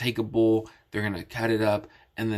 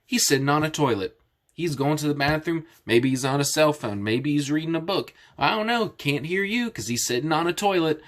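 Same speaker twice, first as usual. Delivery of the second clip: raised-pitch teasing; mocking; 4 semitones higher than usual. Retelling Elijah's jeering at the worshippers, mocking. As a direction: make fun with mock guesses, voice pitched up. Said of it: "he starts making fun of him".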